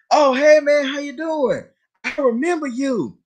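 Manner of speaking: high, bright mock greeting of the husband; friendly man's greeting. Teller mimics the husband greeting her, high.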